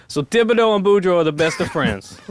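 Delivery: high, animated Cajun storyteller; put-on Louisiana Cajun joke-telling voice; Cajun accent. Shown as a demonstration. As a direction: tell it in a thick Cajun accent. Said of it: "do my best Cajun accent".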